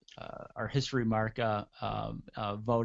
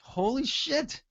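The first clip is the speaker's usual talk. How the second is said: high-pitched awed exclamation; stunned reaction; 12 semitones higher, slower than usual. Retold or imitated. Fan reliving a wrestling promo, stunned. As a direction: marvel at it, pitch jumping up.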